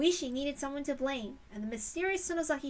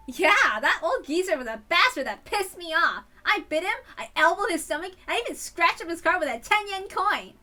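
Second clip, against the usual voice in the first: much higher and louder, cheeky glee; gleeful boasting; 6 semitones higher, 9 dB louder than usual. Acted. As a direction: brag about the mischief, loud and high. Shion boasting about tormenting him.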